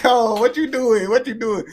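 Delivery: mock bewildered reaction. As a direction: call it back high and loud, playing baffled. Acts out reaction to British caller.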